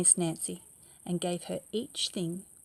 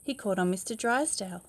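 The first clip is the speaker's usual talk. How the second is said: usual speaking voice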